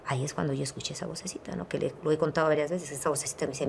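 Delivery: whispering about the voice she heard; hushed confession. Real, not acted. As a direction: drop to a whisper as the memory comes back. Telling of the inner voice.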